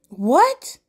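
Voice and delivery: loud, high shocked yelp. Acted. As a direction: jolt out a loud stunned question. Shocked at paid rent.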